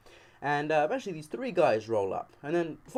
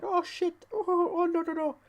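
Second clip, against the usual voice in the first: squeaky, much higher mock panic; panicked. Scared boy facing knives, high squeaky mock panic. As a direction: shriek it like a terrified kid. Panicked retelling under knife threat.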